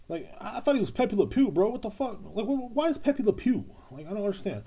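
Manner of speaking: high-pitched, incredulous confusion; baffled protest. Pretend. Comic who thought it was Pepe Le Pew, baffled. Feigns confusion over the mixed-up name.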